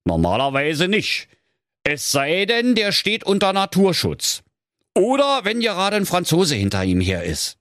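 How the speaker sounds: high, considered fatherly pronouncement. Acted. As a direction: read out the exceptions as a fairy-tale father. Voices father consulting handbook.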